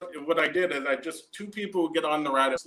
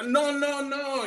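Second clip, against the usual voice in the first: mock scared yell; 9 semitones higher, slower than usual. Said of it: "yelling things like".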